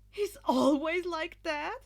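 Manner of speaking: high, loud, slow stunned question. A narrator's reading. Monoma asking about Izuku, high and stunned. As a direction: let the disbelief ring out. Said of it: "still moderately stunned".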